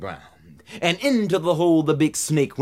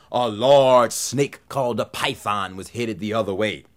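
usual speaking voice

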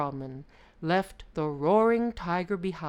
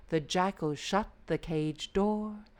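usual speaking voice

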